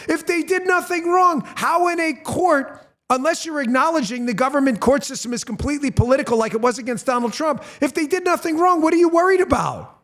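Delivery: high, pushing, incredulous outrage; indignant rant. Real, not acted. Host tearing into pardon excuse for his audience, incredulous outrage. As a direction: hammer the contradiction, rising in disbelief.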